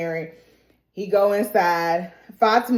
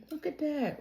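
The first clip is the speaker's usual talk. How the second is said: ordinary talk, nothing marked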